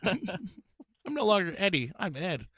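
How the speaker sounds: high cartoonish mock voice; anime character voice. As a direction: tease him in a squeaky anime voice. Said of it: "Ed went through puberty and was like".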